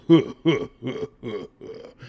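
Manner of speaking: jolly Santa-style ho-ho laugh; imitated Father Christmas chuckle; Santa Claus laugh. Shown as a demonstration. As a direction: demonstrate a spaced-out Santa laugh. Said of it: "like Santa Claus or something".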